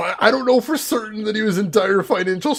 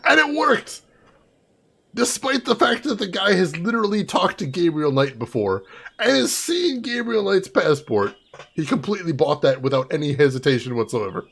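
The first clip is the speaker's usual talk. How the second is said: ordinary talk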